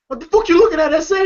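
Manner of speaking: high, loud menacing challenge; threatening. Retold or imitated. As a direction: bark it at him. Joker threatening.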